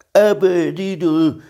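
high, drawn-out musing bear voice; pondering. A narrator's reading. Pooh echoing Tigger at noon, pondering. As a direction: stretch the word out as if pondering it. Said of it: "ismételte Mici Mackó elmélkedve".

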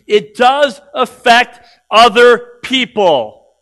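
loud, high, hammering sermon; fiery preaching. Acted. Pastor scolds lazy believers, loud fiery preaching. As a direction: preach it hard at the lazy ones.

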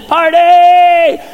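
long, high, loud party whoop; party cheer. Acted. Preacher breaks into heaven's rejoicing, celebrating loudly. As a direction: burst in with a drawn-out cheer.

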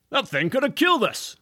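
fast, high alarmed voice; shaken smoker. Acted. Smoker stunned after the fireball, alarmed. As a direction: rattle it off in alarm. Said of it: "one of the other smokers said".

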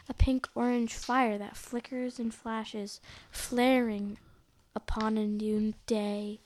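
ordinary delivery

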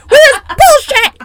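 high, loud hammed-up rant about the sound; mock frantic rant. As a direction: overact a stressed perfectionist. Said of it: "She's like".

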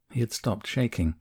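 usual speaking voice

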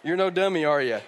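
normal talking voice

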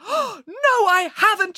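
very high, breakthrough excitement; excited cry. A narrator's reading. Scientist has just made a discovery, excited cry. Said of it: "she cried".

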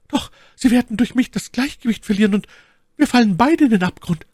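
high, urgent lady's protest; Milady's voice. A narrator's reading. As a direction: object anxiously, pitched far up. (ängstlicher Einwand mit hoher Stimme)

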